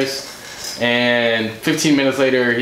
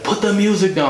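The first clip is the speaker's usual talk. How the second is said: retold yell pitched way up; mock yelling; 10 semitones higher than usual. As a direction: imitate a man yelling, much higher than your own voice. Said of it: "He starts yelling and yelling".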